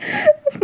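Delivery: a quick, high-pitched giggle; soft amused titter; high little laugh. Acted. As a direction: burst into a short high laugh. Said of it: "वांग जी बस कहता".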